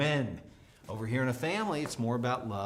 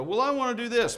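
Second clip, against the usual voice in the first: put-on high, louder teen whine; whiny daughter; 9 semitones higher, 5 dB louder than usual. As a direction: argue back like a stubborn teen. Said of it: "And she would go".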